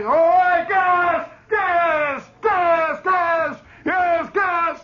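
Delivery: high, loud repeated shout of gas; yelling gas. Retold or imitated. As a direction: bark the drill order at the troops. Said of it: "He's yelling".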